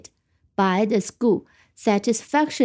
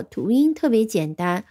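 usual speaking voice

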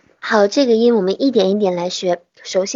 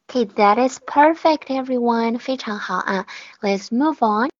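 ordinary talk, nothing marked

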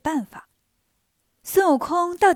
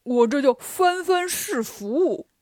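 Bajie clowning; slower than usual. Acted. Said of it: "八戒说".

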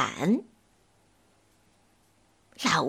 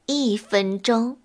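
plain reading voice

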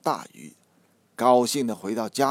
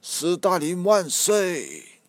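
slow, higher, louder rallying cry; excited cheer; 7 semitones higher, 4 dB louder, slower than usual. A narrator's reading. Fish hailing Stalin from the river, excited shout. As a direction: hail Stalin like a rally slogan. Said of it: "激动地高呼".

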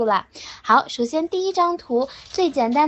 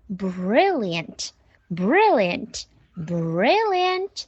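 "brilliant" very slow and stretched; slow repetition drill; 4 semitones lower, much slower than usual. Shown as a demonstration. Teacher drilling praise for mom, slow repetition drill. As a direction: draw out each "brilliant" with gaps between. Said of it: "我们可以多练几遍".

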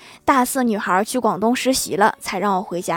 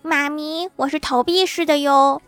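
put-on higher-pitched, calm little-kid voice; unruffled child; 5 semitones higher than usual. Son replying to his mother's order, unruffled. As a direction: play a kid coolly answering mom. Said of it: "郭晓霞淡定地说".

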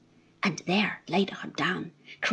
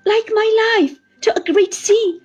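high, loud despairing lament; 6 semitones higher, 6 dB louder than usual. Acted. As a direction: pour out hopeless grief. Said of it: "the tone of those words".

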